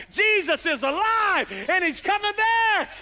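high, loud joyful cry; ecstatic cry. Retold or imitated. Preacher playing a fox running through town, crying out high and loud. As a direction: shout it like you're on fire. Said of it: "running through the town like".

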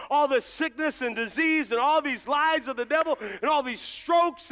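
loud, driving revival preacher; forceful sermon declaration; full-force preaching. Real, not acted. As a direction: drive out the devil with a rising pulpit cry.

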